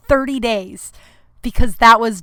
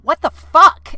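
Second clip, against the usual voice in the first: higher, louder indignant outburst; indignant, raised voice; retold outrage; 6 semitones higher, 5 dB louder, slower than usual. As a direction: protest in shock, replaying the moment. Acting out reaction to being grabbed.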